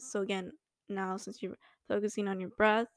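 normal talking voice

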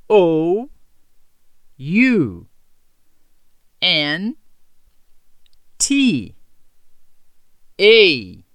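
normal talking voice

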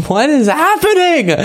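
very high, laughing disbelief; baffled outburst. Real, not acted. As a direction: burst out in a high, bewildered cry. Host laughs, baffled by clip.

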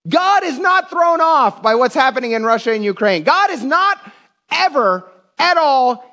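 high, slow, drawn-out proclamation; emphatic preaching. Real, not acted. Preacher assures church God is never thrown off, impassioned proclamation.

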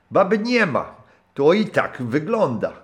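put-on high, loud hearty old sailor's voice; a rough old skipper warming to his guest; gruff old captain. (serdeczny, szorstki głos starego kapitana)

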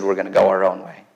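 a hint of a defiant people, low and soft; voiced Israel's defiance. Preacher voicing Israel defying God, lightly mocking. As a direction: lightly mimic stubborn rebels going their own way.

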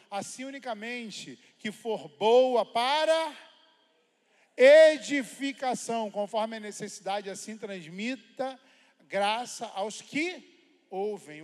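slow, loud, drawn-out scripture reading; preached scripture reading. Acted. Pastor proclaiming the verse to the church, slow and loud. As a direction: proclaim each word to the congregation.

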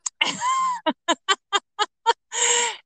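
long, high-pitched laugh; delighted laugh. Real, not acted. Host reacts to guest's ember metaphor, delighted high laugh.

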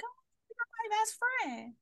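high, gushing mock best-friend voice; mock a tipsy coworker warming up; gushy tipsy coworker. Imitating coworkers after drinks at happy hour, gushy.

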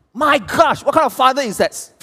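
high, loud, indignant outburst; outraged exclamation. Real, not acted. Pastor protests the father's advice, loud and high. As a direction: cry out in disbelief.

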